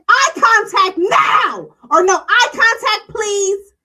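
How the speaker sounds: demanding, high and loud retelling; berating shout. Retold or imitated. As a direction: shout it like a wife berating her husband. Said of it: "Toya begins to berate Eugene".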